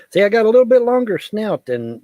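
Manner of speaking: cartoon dog. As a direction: speak as the little dog. Speaking as the dog.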